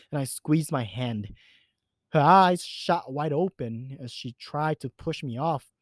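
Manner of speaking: ordinary delivery, nothing marked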